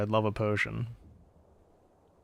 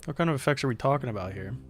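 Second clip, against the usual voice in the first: ordinary delivery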